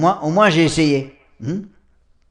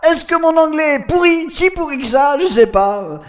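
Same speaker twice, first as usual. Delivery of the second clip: laughing; 7 semitones higher, faster than usual. Real, not acted. Laughs at his bad English.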